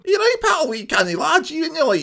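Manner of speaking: high-pitched Geordie attempt that turns Scottish; failed Geordie accent. Shown as a demonstration. YouTuber showing viewers his failed Geordie accent. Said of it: "it goes Scottish every time".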